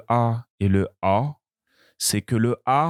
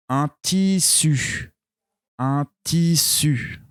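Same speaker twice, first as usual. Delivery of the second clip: un tissu said slowly, slightly high; slow dictation; 3 semitones higher, slower than usual. Shown as a demonstration. Teacher dictating words, slow. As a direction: ease out un tissu. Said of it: "Vous entendez U à la fin".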